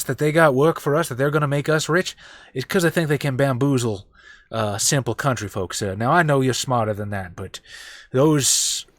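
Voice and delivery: down-home Texan warning; San Antone country voice, earnest and persuasive; folksy San Antone character. Acted. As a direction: caution the boss like wary country folk. Player back in character, persuading.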